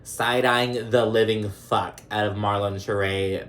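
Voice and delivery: ordinary talk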